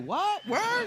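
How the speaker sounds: mock-shocked little kid, very high-pitched; imitating little dude. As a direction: squeak it out like a stunned little boy. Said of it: "the little dude in the front was just like".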